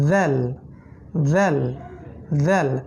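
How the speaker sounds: জেল said with the tongue tip at the teeth; জেল, light, tongue forward; Arabic letter demo. Shown as a demonstration. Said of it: "ঠিক একই জায়গা থেকে আপনাকে বলতে হবে".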